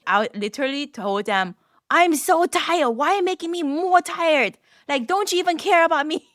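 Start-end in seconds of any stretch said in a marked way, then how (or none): 1.9-6.2 high, whiny retold outburst; mock worn-out wail; unhinged tired mom. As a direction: plead as an exhausted mom at the limit. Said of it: "The unhinged, tired mom".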